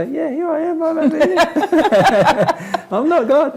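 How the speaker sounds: giggling high call from across the room; laughing call-out. Pretend. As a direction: wave from over here, cracking up. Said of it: "And I go".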